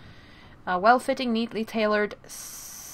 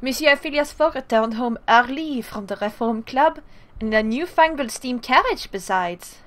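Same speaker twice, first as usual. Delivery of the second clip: Passepartout in a French accent; French-accented narration; 2 semitones higher than usual. Shown as a demonstration. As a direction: redo it as the French valet. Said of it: "Take two".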